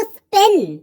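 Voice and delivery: स्पिन with only a weak puff on the P; lightly released P in स्पिन. Shown as a demonstration. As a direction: say स्पिन, little breath escaping after the P. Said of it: "पी पर वीक puff पड़ रहा है".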